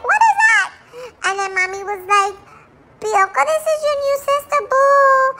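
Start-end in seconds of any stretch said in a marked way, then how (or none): 0.1-0.7 a sudden high squeal of surprise; startled squeal. Retold or imitated. Startled dog quoting herself. 3.0-5.3 a higher, sing-song imitation of Mom; mimicking Mommy. Dog voicing Mommy.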